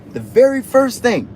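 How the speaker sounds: usual speaking voice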